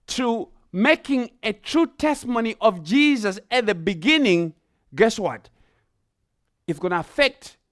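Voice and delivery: rising, forceful sermon delivery; preaching. Acted. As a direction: press the point home to the congregation.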